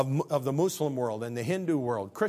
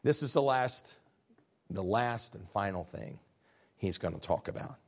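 normal talking voice

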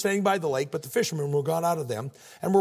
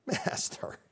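softer, drawn-out mock deference; condescending; 6 dB quieter, much slower than usual. Peter humouring Jesus the carpenter, condescending. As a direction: say it like humouring someone who knows nothing. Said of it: "It's like that condescending".

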